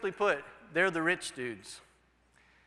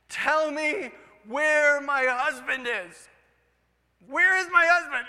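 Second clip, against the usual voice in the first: loud, higher and slow, near hysterical; hysterical widow; 8 semitones higher, 8 dB louder, slower than usual. Retold or imitated. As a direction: plead frantically for her husband. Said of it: "she was just hysterical too".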